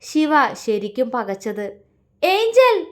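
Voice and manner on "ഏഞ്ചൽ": shouting her name in shock; loud call. A narrator's reading. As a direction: cry out high and loud. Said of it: "Shiva ഉറക്കെ വിളിച്ചുകൊണ്ട്". (ഉറക്കെയുള്ള വിളി)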